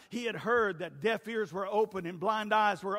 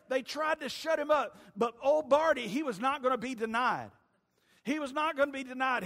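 ordinary talk, nothing marked

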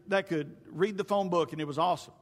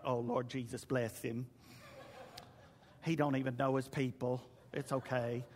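pitying congregation; 8 semitones lower, 10 dB quieter than usual. Retold or imitated.

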